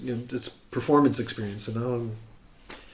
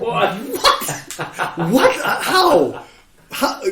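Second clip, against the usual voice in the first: high, louder shocked disbelief; stunned disbelief; 10 semitones higher, 6 dB louder, much slower than usual. Retold or imitated. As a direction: caught totally off guard. Shocked at being called up.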